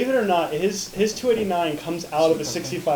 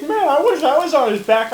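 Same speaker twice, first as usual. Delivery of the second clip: dog voice; 10 semitones higher, 7 dB louder than usual. Pretend. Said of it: "He's like".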